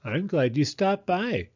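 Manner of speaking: high, kindly storybook mole voice; friendly Mole character. Acted. Mole replying to Hedgehog's thanks, high friendly character voice. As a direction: answer fondly in a put-on animal voice.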